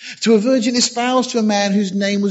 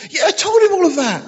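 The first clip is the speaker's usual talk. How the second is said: mock-hurt Gabriel; 11 semitones higher, 3 dB louder than usual. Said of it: "Gabriel getting back to heaven".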